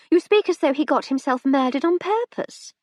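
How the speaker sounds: a shocked wife reining in her husband; wife rebukes tipsy Percy; scolding wife. A narrator's reading.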